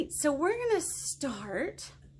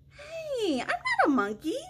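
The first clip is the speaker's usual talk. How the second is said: mock protest; 11 semitones higher than usual. Reader protests in mock dismay.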